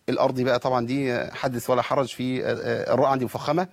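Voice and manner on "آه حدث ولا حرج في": ordinary talk, nothing marked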